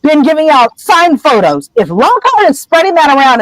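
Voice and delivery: high, indignant protest, voice jumping up; furious denial. Retold or imitated. Harry denying signed photos to Hagrid, furious and high. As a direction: bristle and deny it hotly. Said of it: "immediately he's furious".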